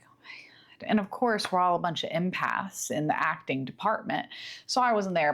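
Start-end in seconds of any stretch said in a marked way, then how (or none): 0.1-0.9 whispered, barely audible horror; mock stunned whisper. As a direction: imitate a shocked class under your breath. Classmates' stunned reaction, whispered.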